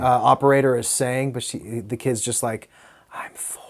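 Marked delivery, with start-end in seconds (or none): 3.1-3.6 barely audible whisper, imitating a small kid; tiny hushed imitation of a child. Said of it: "Why are you whispering?".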